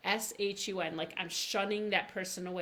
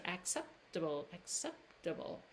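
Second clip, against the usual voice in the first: acceptable said quicker and softly; faster; 8 dB quieter than usual. Shown as a demonstration. Teacher saying acceptable faster for practice. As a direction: run acceptable together faster. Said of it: "say it a little bit faster".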